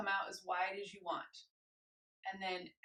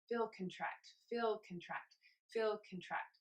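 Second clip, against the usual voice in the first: slower, softer "feel contract" chant; slow sleeping heartbeat; 4 dB quieter than usual. Shown as a demonstration. Instructor cueing a sleeping heartbeat, soft slow chant. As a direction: let it drift like a heart at rest. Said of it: "now it's a little bit slower".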